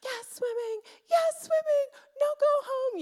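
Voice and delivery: put-on very high childlike wail of protest; imitated child yelling. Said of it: "she just starts yelling".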